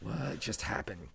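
soft, slow stunned wonder; awed disbelief. Retold or imitated. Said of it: "I just stood there just like".

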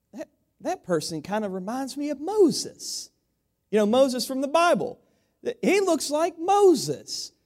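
lightly voiced puzzled George W. Bush, slowing down at the end; half-acted wondering aside to himself; squinting wonder. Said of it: "He's like".

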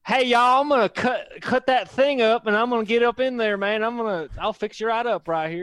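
high, loud hammed-up Southern surgeon; thick Alabama accent. Scene: surgeon telling the patient the plan, thick Alabama accent. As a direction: play the folksy surgeon with a big Alabama twang. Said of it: "She had a really thick Alabama accent".

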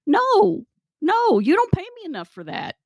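mounting comic indignation, pitch way up; indignant protest. Retold or imitated. Underpaid worker refusing the manager's request, indignant.

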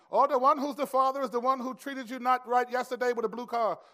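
loud, high mock-Jamaican psychic; hammed-up fake Jamaican fortune-teller reveal; fake Jamaican accent. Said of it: "a Jamaican accent 'cause she wasn't really Jamaican".

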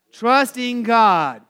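Trusting God called out slow and loud; loud, slow answer. Shown as a demonstration. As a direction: lead the crowd's answer, drawn out. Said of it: "one more time".

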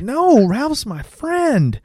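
put-on very high, drawn-out child voice; little girl. Little daughter defending her friend, high childlike voice. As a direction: stretch it out like an innocent little kid. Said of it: "his little daughter".

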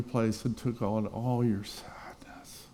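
slow breathy whisper, voice dropped out; hushed whisper. Real, not acted. Preacher to congregation, whispering slowly about Jesus.

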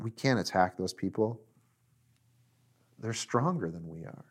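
soft, low discouraged quote; subdued defeat. Acted. The other ten spies refusing to attack, subdued. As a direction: drop low and quiet, giving up.

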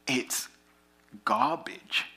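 soft breathy whisper on "garbage". Acted. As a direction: breathe "garbage" almost without voice.

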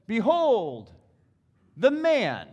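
high, loud proclamation; proclaiming. A narrator's reading. Preacher voicing Pilate presenting Jesus to the crowd, loud and high. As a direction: announce it to the crowd.

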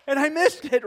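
laughing through the words. Real, not acted. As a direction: laugh at the memory.

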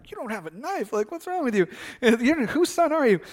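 play-scold your son in disbelief; mock incredulous scolding. Dad's mock scolding in a story.

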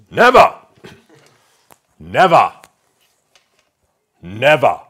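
loud, booming, drawn-out never; Churchill impression. Preacher doing a rough Churchill impression, loud. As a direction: growl each never like a stubborn old statesman. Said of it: "I can't really do his accent".

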